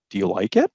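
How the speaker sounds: "Do you like it" lifting in pitch on "it"; rising question tone. Shown as a demonstration. Teacher demonstrating intonation to students, rising tone at the end. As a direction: let "it" rise to signal a question. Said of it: "That's rising tone to signal a question".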